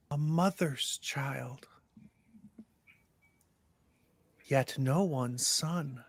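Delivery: put-on slow, eerie child ghost riddle; drawn-out spooky riddle. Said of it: "this ghostly voice".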